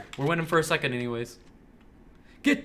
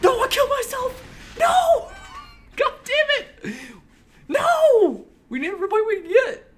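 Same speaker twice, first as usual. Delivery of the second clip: real panicked yells, much higher and louder; panicked shouting; 11 dB louder than usual. Real, not acted. Gamer who just killed himself with a grenade, panicked shouting. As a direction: scream it out after blowing yourself up.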